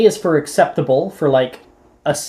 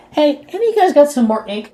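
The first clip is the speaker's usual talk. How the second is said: casual classmate; 11 semitones higher, 3 dB louder than usual. Acted. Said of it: "Morgan's gonna turn around ... and goes".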